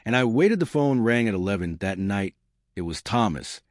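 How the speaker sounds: ordinary delivery, nothing marked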